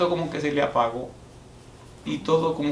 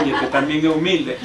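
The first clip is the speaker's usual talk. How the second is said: half-laughing through the words; 2 semitones higher, 6 dB louder than usual. Real, not acted. Pastor to congregation, chuckling at his own joke. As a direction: let the laugh break in as you tell it. Pastor retells joking with the boy.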